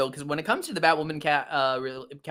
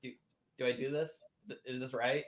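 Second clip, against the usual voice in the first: much softer, unsure whisper-like question; nervous hush; 3 semitones lower, 11 dB quieter than usual. Retold or imitated. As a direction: ask it like a nervous first kiss.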